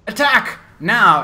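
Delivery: loud, high battle order; shouted villain command. Acted. Necromancer orders his undead to attack, commanding shout. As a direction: call the army to charge. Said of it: "you hear him go".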